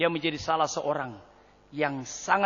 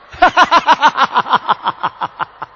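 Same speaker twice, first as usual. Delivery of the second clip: loud burst of laughter trailing away; hearty laugh; 9 semitones higher, 11 dB louder than usual. Real, not acted. Preacher amused by hasty applause, hearty laugh. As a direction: laugh out loud, gradually fading.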